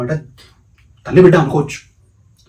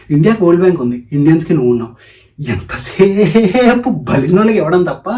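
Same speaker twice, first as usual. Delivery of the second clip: loud, acted indignant complaint; indignant, stretching words for effect; getting angry; 4 semitones higher, 5 dB louder than usual. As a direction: complain louder as mock anger builds. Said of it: "నాకు కోపం వచ్చేస్తుంది".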